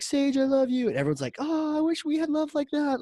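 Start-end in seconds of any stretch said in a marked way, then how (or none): 0.0-0.9 high, loud imitated declaration of love; mock-adoring call, pitched way up; Tony Robbins. 1.4-3.0 imitated crowd going "aww"; mock swooning.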